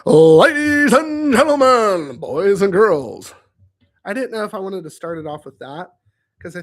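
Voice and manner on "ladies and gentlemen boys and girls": slow, loud booming announcer. Shown as a demonstration. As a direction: announce it like a ringmaster, slowly. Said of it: "who wants to talk like that?".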